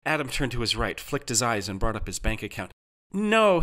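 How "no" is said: high, loud moan of dismay; drawn-out, high wail of denial. A narrator's reading. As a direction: moan it out in disbelief. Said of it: "he moaned again".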